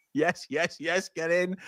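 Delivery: delighted fan reacting to the goal; real goal celebration. Real, not acted. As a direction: cheer the goal as it goes in. Celebrating a Sunderland goal live.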